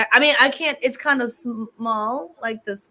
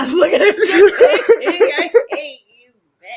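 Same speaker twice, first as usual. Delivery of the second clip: loud laughing high voice; 7 semitones higher, 10 dB louder than usual. Real, not acted. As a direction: laugh through the words. Laughing at her friend.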